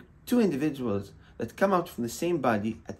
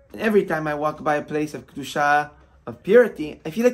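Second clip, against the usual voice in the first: panicked, building; 3 semitones higher, 7 dB louder than usual. Retold or imitated. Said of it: "she was panicking".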